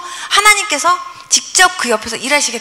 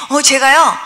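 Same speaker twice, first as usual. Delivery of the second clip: normal talking voice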